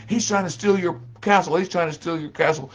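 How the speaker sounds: high, loud chant-like taunting; egging-on crowd. Acted. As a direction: stir up the crowd. Savages egging on.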